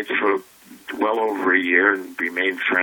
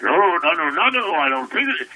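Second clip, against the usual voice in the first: imitating Robin; 9 semitones higher than usual. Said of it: "Oh, Robin should say".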